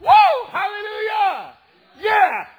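high, whooping shout; real jubilant holler, long drawn hallelujah; exultant praise. Real, not acted. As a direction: burst into loud church praise. Preacher bursts into praise.

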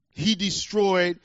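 ordinary delivery

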